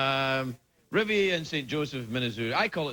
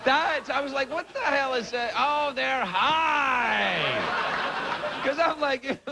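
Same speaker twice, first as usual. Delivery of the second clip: laughing; 10 semitones higher, slower than usual. Real, not acted. Laughing about high audience.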